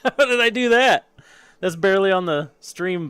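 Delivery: high, loud laughing disbelief; laughing amazement. Real, not acted. Streamer to viewers after a lucky flip, laughing amazement. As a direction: burst out astonished at your own fluke.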